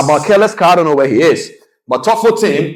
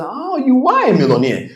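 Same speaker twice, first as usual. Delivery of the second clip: high, far-off mock voice of the inner mind, suddenly convinced; surprised subconscious; 13 semitones higher than usual. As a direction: squeak it out high as the mind finally gives in. Subconscious finally agrees.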